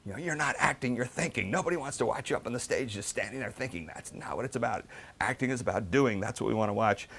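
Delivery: retold screaming. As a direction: imitate the teacher yelling, pitched well up. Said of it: "she just starts screaming at me".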